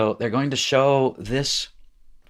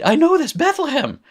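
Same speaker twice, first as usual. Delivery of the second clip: squealing high with delight at spotting it; a sudden gleeful aha, pitched way up; excited discovery; 6 dB louder than usual. Real, not acted. Teacher delighted to show students where Bethlehem comes from, squealing excitedly.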